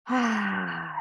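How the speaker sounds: long, falling, open sigh; demonstrated sigh of release; relieved sigh. Shown as a demonstration. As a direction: let the breath go on an "ah".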